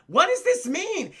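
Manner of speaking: high, loud dramatic question; mock bewildered Luigi. Luigi puzzled by the helpful mold, high mock bewilderment. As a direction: ask it in hammed-up wonder.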